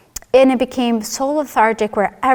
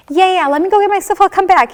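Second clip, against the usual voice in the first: much higher, quick dismissive agreement; shocked brushing off; 9 semitones higher, 5 dB louder than usual. Retold or imitated. Told she has cancer, she brushes the doctor off in high-pitched shock. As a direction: agree brightly while refusing to hear it. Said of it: "I'm in shock".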